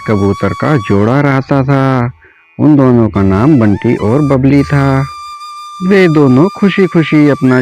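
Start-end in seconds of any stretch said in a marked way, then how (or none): none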